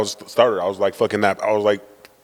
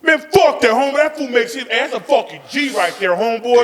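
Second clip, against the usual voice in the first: hyped-up dad; 11 semitones higher than usual. Retold or imitated. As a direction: do dad cheering the ditching friend on. Dad voiced hyping up friend.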